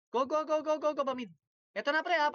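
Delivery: high, loud rallying call to push in; excited callout. Real, not acted. Gamer rallying his squad, loud and hyped. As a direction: fire the team up to go.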